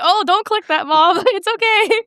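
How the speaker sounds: high, laughing warning to mom; mock panic. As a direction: imitate a flustered daughter heading off disaster.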